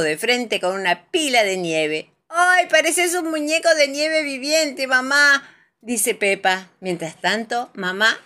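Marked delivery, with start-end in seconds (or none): 2.3-5.5 exclamación aguda de niña divertida; voz de niña. A narrator's reading. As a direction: exclama fuerte, con asombro infantil. Peppa exclama ante su mamá. (voz aguda de hija de cuento)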